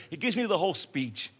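usual speaking voice

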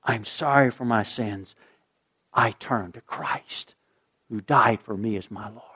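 quiet sinner's prayer, fading to a whisper; hushed prayer. Retold or imitated. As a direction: confess it under your breath. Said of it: "when you say".

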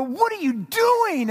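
high, slow mock yell; retold yell. Youth worker yelling at teen after rude gesture, high mock yell. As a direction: stretch it out high like a shocked driver. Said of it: "and he just yelled".

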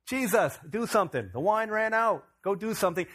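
loud, high, urgent mom nagging her son; pushy mother calling out, pitch jumped up; demanding mom. Retold or imitated. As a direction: order your son to fix it, loudly. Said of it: "what does she, mom, say?".

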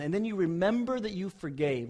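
ordinary talk, nothing marked

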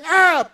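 short high shriek of fright; a sharp squeal as the car thumps; retold scream.

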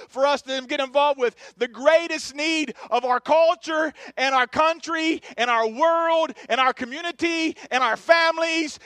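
loud, driving pulpit delivery; impassioned sermon, building by repetition; full-force preaching. Real, not acted. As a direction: hammer the point home to the congregation.

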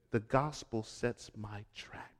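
hushed, breathy statement of conviction; whispered. Acted. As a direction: drop it to a near whisper for the room. Preacher drops to a whisper.

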